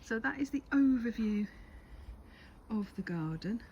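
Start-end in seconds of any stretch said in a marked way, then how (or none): none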